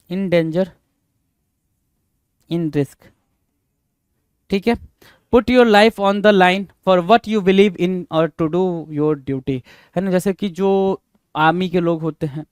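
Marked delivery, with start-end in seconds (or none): 5.3-9.6 slow and even; slow dictation. Shown as a demonstration. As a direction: dictate the full sentence slowly. Dictating full sentence.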